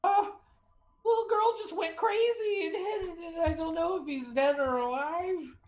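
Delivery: high, tearful mouse voice; the mouse near sobbing as it reports; whimpering high mouse voice; distraught mouse. Acted. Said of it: "And then he says".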